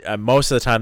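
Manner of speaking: normal talking voice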